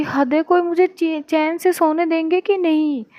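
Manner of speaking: high-pitched exasperated complaint; exasperated protest. Acted. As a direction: protest like someone fed up with disturbances. Sleepy niece protests being disturbed. (झुंझलाकर ऊँचे सुर में शिकायत)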